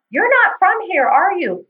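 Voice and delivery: lightly mimicked high-pitched questioning; imitating older lady. Older lady sizing up a stranger mid-race, lightly mimicked high prying.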